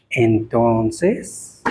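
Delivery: drawn-out, low questioning retort; pointed retort. Acted. Preacher answering a girl's doubt about her boyfriend, pointedly questioning. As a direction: sink low, then swing up expectantly.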